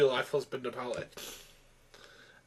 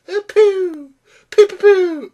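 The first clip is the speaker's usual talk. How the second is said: put-on very high, loud silly toddler voice; mock toddler; 10 dB louder, slower than usual. Reviewer mocking toddler humour, silly and childish.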